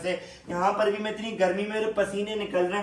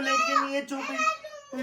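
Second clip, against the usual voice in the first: mock crying; 4 dB quieter, much slower than usual. Mother voice, baby won't stop.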